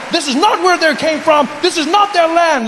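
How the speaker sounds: high, emphatic mock indignation; self-righteous complaint. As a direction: proclaim it like a cocksure nativist. Mocks confident anti-immigrant speakers.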